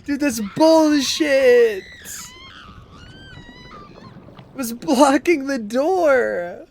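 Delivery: slow, loud indignant rant; raging gamer. Real, not acted. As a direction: yell at the cheating enemy. Raging at the game.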